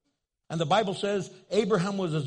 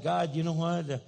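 lower, half-hearted complaining prayer to God; lightly mocked nagging prayer; mock whiny prayer; 6 semitones lower, 4 dB quieter than usual. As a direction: pester God like an impatient believer. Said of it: "Have you ever prayed like that?".

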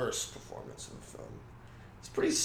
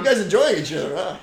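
loud, higher mocking cop; 6 semitones higher, 8 dB louder than usual. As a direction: tease the couple like a nosy cop. Acts cop interrupting couple.

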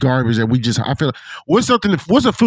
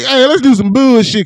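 mocking voice; 8 semitones higher, 5 dB louder than usual. Mocking how they talk.

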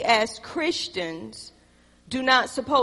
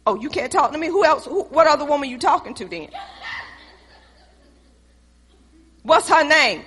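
higher, louder jealous demands; jealous outburst; 3 semitones higher, 3 dB louder than usual. Acted. Wife accusing her husband of another woman, loud and jealous. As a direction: accuse him, rising to a yell.

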